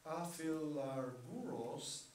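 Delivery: aphilarguros drawn out slowly, syllable by syllable; slow Greek word. Shown as a demonstration. Teacher introducing the key Greek word to students, slow and careful. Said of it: "You'll notice that".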